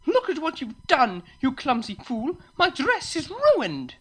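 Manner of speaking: high, indignant scolding; outraged shout. Acted. Unicorn with wine-stained dress scolding the pair, shouting. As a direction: shriek in outrage, drawing out the end. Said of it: "turned toward the two and shouted".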